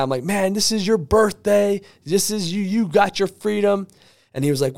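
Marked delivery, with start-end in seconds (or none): 0.2-3.9 higher-pitched, mild mock yell; retold cheering. Speaker cheering for the fired relative, retold excitement. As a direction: cheer him on without full volume. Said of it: "I'm yelling, I'm cheering for this guy".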